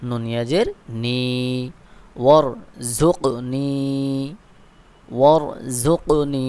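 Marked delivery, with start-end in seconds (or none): 2.2-6.5 ওয়ারজুকনি stretched out very slowly; slow recitation. Shown as a demonstration. Teacher reciting slowly for students to repeat. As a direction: draw out each syllable for learners. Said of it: "বানান করে পড়ব".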